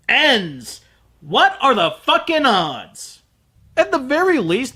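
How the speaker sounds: ordinary delivery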